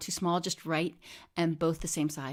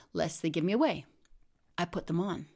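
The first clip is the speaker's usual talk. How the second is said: ordinary delivery, nothing marked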